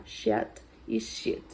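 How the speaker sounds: sheet said twice, slow whispered contrast of i and e. Shown as a demonstration. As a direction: compare the two sheets slowly. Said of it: "você fala com som de I mesmo".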